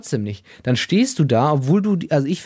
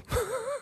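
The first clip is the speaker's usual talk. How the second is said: high giggle; 13 dB quieter than usual. Real, not acted. Laughs at own image.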